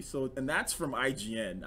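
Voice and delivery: usual speaking voice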